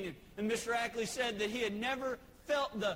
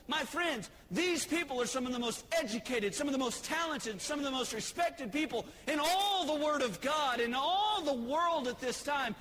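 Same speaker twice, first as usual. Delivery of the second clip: fiery preaching; 5 semitones higher than usual. Acted. Preacher to congregation, building fiery preaching. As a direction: preach it, building to the witnesses' cry.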